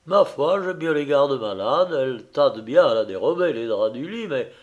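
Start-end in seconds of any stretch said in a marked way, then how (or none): none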